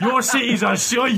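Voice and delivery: drawn-out high mocking jeer, laughing; mock American taunt. Lads mocking Americans on Call of Duty, laughing jeer. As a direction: sneer at the Americans through laughter.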